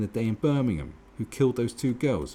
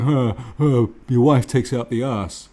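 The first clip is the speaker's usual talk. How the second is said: louder, taunting yob voice; jeering fan shout; 3 semitones higher, 8 dB louder than usual. Retold or imitated. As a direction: jeer across the pitch at the player. Football fan taunting Beckham.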